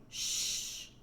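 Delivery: whispered soothing shh; soothing shush. A narrator's reading. Girl comforts crying father with a whispered shush. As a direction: hush him like a scared child. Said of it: "like he was a scared little kid".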